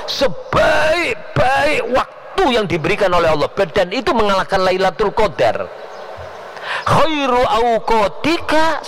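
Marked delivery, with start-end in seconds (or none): 0.1-3.5 high, slow, shouted declaration; preaching shout. Acted. Preacher to congregation, slow high proclaiming shout. 6.9-8.9 high, slow chanted Arabic; high drawn-out recitation. Acted. Preacher reciting the maxim to the crowd, slow high chant.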